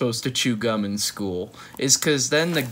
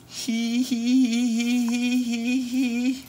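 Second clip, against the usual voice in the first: giggle; 10 semitones higher, slower than usual. Real, not acted. Giggling after reading.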